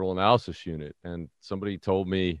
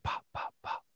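tiny, voiceless little pops; very soft popping, barely any voice; muffled gunshot imitation; 15 dB quieter than usual. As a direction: imitate gunfire heard as faint puffs. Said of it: "it just sounded like".

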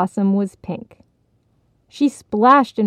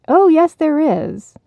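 higher, louder call from afar; 8 semitones higher, 5 dB louder than usual. Acted. Crane calling to the frog from the pond's edge, higher and louder. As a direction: call across the pond, teasing. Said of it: "a voice called from the edge".

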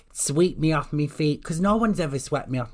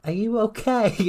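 quoting a concerned stranger; 8 semitones higher than usual. Retold or imitated. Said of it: "this couple sat next to me was like".

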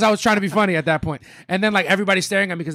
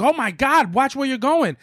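higher-pitched mock outrage; startled scolding; 7 semitones higher, slower than usual. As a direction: imitate an alarmed pedestrian scolding a cyclist. Acted startled old couple.

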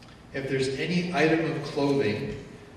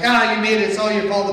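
loud accusing yell, imitating; mock angry blame; 8 semitones higher, 12 dB louder than usual. Preacher acting someone blaming another in anger. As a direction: throw the blame in a hammed-up rage.

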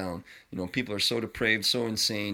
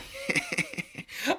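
sudden high laugh at a fart; a high giggle bursting out; high burst of laughter. Real, not acted. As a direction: break into a squealing laugh.

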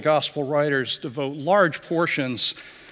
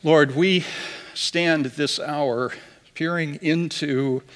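prayer. Acted. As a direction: lead the congregation in prayer, unhurried.

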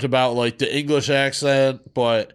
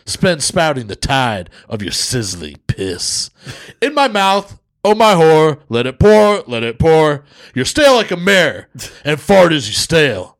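Def Jam poetry slam; 5 dB louder than usual. Acted. Co-host reciting a crude poem for listeners, Def Jam style. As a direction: deliver it like a Def Comedy slam act. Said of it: "I'm gonna do it like a fucking, a Def Jam".

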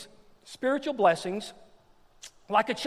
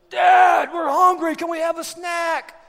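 high, loud holler for Dad; kids yelling; 8 semitones higher, 9 dB louder than usual. Retold or imitated. Kids yelling to their dad for a snack, shouting. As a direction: imitate hungry kids shouting downstairs. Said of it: "They just yell".

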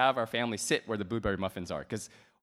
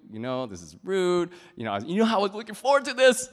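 building to a loud, high scolding; retold anger; 8 semitones higher, 8 dB louder than usual. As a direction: imitate a fed-up dad losing patience. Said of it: "I got so mad at her".

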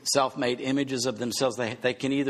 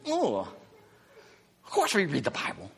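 mock indignant; 3 semitones higher, slower than usual. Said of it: "Jesus is like".

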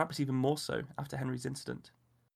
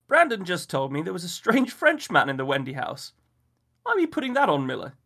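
louder, higher man's voice; a husband teasing a friend; amused host; 5 semitones higher, 10 dB louder than usual. A narrator's reading. Henry teases Miller at the window, louder.

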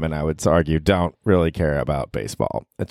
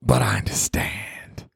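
Chris Rock bit; 4 semitones higher, slower than usual. Retold or imitated. Comedian quoting a famous punchline, hushed and slow at the end. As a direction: drop to a hushed slow whisper on the last words. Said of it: "Saying "I understand" in that tone of voice".

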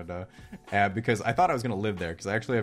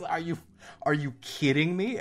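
higher pitched, incredulous retort; can't believe what he hears; disbelief; 8 semitones higher than usual. Retold or imitated. Shocked by the requirement.

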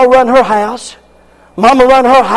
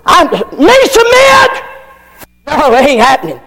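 mock defiant wife; 3 semitones higher than usual. Preacher mocking a defiant wife, high indignant shout. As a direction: shriek it like an outraged wife.

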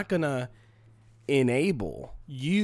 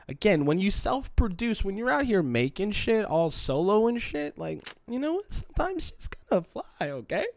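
high voice to make a point; 9 semitones higher than usual. Real, not acted. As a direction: voice pushed way up to sell a point. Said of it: "I go high on the voice to make a point".

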